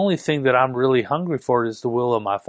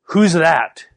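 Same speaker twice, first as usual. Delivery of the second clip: loud, higher, strong demand; very stern; 4 semitones higher, 5 dB louder than usual. Retold or imitated. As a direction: retell it as a stern, forceful challenge. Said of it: "he said, very sternly, very strong".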